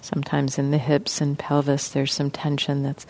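soft, even voice with long pauses; guided meditation. Real, not acted. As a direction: gently guide listeners into relaxing, leaving space between phrases. Leads a guided body-scan meditation.

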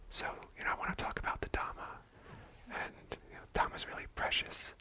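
barely audible timid whisper, rushed; withdrawn whisper. Retold or imitated. Teacher imitating her old timid Dhamma talk, whispering. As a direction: shrink into a whisper as if you don't belong. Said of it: "my voice would be kind of withdrawn".

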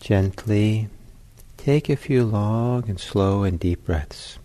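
unhurried, spacious guidance, letting each phrase settle; guided meditation. Real, not acted.

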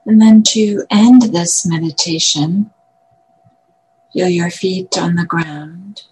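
calm meditation guidance closing out; grounding exercise. Acted. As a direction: gently bring listeners back to the body. Ends meditation, grounding the body.

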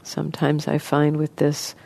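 soft, even voice with long pauses; guided meditation. Acted. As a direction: gently guide listeners into relaxing, leaving space between phrases.

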